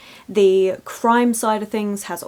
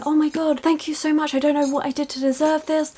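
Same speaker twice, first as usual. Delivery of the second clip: higher, flustered, overwhelmed gratitude; gushing apologetic thanks; 6 semitones higher than usual. Retold or imitated. Barbie accepting an award, gushing and humble. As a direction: act like you don't deserve a thing. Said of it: "then they all start saying".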